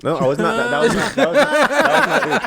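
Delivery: high, loud protest through laughter; loud laughing denial. Real, not acted. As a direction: shout it down while laughing. Denying loudly, laughing.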